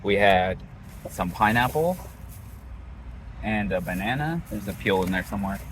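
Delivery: talking through a toothbrush; while brushing teeth. Real, not acted. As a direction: mouth full of toothpaste, mumbled. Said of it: "I'm brushing my teeth".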